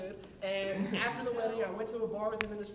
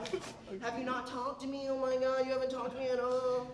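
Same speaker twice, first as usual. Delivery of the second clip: higher-pitched imitation of a co-worker's complaint; put-on voice of a reproachful girlfriend; imitating Dizzy; 4 semitones higher than usual. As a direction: mimic an indignant woman confronting you. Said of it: "that's how she sounds, I swear".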